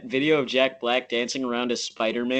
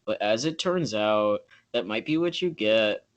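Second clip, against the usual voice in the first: slow, soft, deadpan countdown narration; Chills narrator voice; 6 semitones lower, 7 dB quieter, slower than usual. Retold or imitated. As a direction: read it low and slow like a creepy list video. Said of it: "he can do a really good Chills impression".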